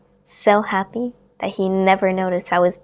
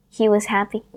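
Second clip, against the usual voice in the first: ordinary delivery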